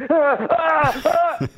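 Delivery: broken high cries of disbelief; stuttering high scream, refusing to believe; disbelieving death scream. Shown as a demonstration. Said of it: "this can't be happening death scream".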